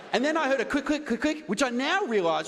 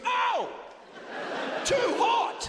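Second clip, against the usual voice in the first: masseuse yelping; 9 semitones higher, much slower than usual. Retold or imitated. Comic imitating the masseuse burning her hand, high startled yelp. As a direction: squeal it like burnt fingers. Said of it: "She goes".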